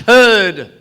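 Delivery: herd said loud and final, correcting; stress on herd. Real, not acted. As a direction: punch the word herd as the correction. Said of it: "Not a bunch".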